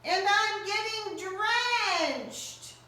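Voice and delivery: high, anxious little rabbit pleading at the door; scared rabbit begging shelter from storm; Rabbit's voice. Acted. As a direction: plead to be let in, small and high.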